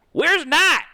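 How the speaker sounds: loud call. Acted. Calling out for missing friend.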